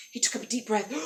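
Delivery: ordinary delivery, nothing marked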